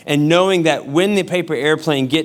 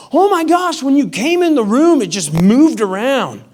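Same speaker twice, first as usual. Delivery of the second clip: high, louder-to-easing mock complaint; put-on voice of an exasperated wife; imitated upset wife; 9 semitones higher, 4 dB louder than usual. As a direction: start high and agitated, then come down. Voices his agitated wife.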